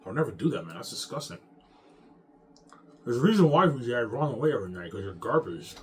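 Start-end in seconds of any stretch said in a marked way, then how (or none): 3.0-5.8 loud rant. Real, not acted. Ranting at enemies, shouting.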